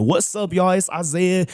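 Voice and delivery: high, animated intro with life in it; lively video greeting. Retold or imitated. Vlogger showing his improved video intro, lively greeting. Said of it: "I remember just getting better. Now that when I do my videos".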